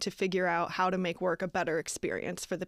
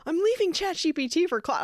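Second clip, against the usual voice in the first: mock eager convert; 10 semitones higher, 3 dB louder than usual. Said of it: "everyone on the videos being like".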